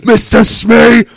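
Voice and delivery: slow booming shout of the name; pirate shout. Retold or imitated. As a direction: bellow it like a pirate captain.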